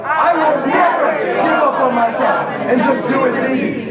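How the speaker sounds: loud, high crowd chant; shouted vow. Shown as a demonstration. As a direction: lead the room in a shout.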